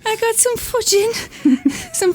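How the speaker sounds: slow, high, put-on Scouse; giggling through a mock Scouse line; Scouse impression. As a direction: drag out the fudging in a mock Liverpool voice. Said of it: "I can't do the impression".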